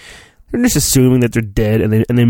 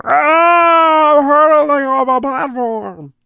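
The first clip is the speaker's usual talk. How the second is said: hammed-up high cry, then the words higher; dramatic fall cry; 11 semitones higher, slower than usual. Reading the storybook, hamming up Obi-Wan's fall with a high cry. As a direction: scream as if falling, overdone.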